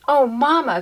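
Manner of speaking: high, loud eager cry; excited cry. Acted. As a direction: call out to her mother in delight. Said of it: "cried Fernie".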